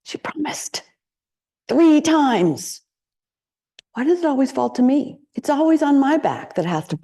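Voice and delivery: high, aggrieved, self-pitying complaint; martyr's grumble, pitched high and pushed; resentful inner voice. Retold or imitated. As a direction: whine it like the one always stuck with it.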